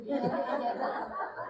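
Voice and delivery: short high laugh under the breath. Real, not acted. Laugh after silent thought.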